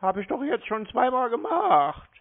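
usual speaking voice

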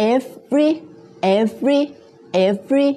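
"every" in two separated syllables, ev-ry; careful teacher's "every", ev then ry; two-syllable pronunciation. Shown as a demonstration. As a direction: say "every" as two beats, lifting the second. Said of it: "every สองพยางค์นะคะ สองพยางค์".

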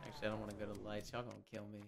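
very soft, fast muttering; quiet. Real, not acted. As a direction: mutter to yourself while hiding. Hiding from other players.

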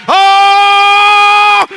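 long, high, loud sustained "oh"; full-voiced cry at the sermon's peak; ecstatic preacher's shout. Real, not acted. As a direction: let out a held revival holler. Climax of fired-up preaching.

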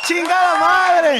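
slow booming shout; announcer shout. Pretend. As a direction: belt it out to end the ring call. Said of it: "como pinche anunciador de lucha libre".

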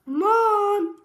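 high, loud, drawn-out call; crying out. Acted. Froggy back home calling Mom, loud cry. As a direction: wail loudly for a parent. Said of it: "cried Froggy".

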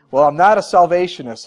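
loud, curt comeback, moderately acted; snapped retort. A narrator's reading. Church overseer rejects the preacher's label, acted loud snapped retort. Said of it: "he snapped".

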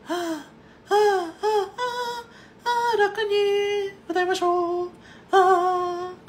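easy, light high "あー", slow and unforced; relaxed falsetto. Shown as a demonstration. Coach demonstrating the right relaxed falsetto to students, slow and easy. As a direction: float it up with no pressure.

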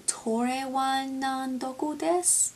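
slow, unsure "Tore wa nan doku desu" in a poor Japanese accent; bad Japanese accent. Shown as a demonstration. Second guess at the phrase, slow, in a bad Japanese accent. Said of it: "ignore the really bad accent".

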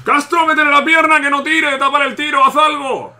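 high, loud urgent yelling; breakneck goal commentary. Real, not acted. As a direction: shout at the player to block it. Urging defender during goal chance. (comentario frenético de gol)

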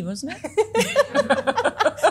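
high, loud sheepish laugh through the words; laughing admission. Real, not acted. Owning up to crying in the toilets, laughing high. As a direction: own up with a giggle.

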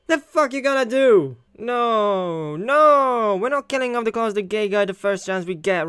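shouting back at the masked figure; angry challenge. Acted. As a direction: square up to the villain. Jeff challenges the armed host.

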